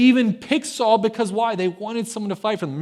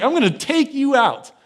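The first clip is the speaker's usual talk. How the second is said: higher-pitched retold threat; David's serious threat; 7 semitones higher than usual. David threatens Goliath, bold smack talk. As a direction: punch out a mock challenge. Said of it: "that's some serious".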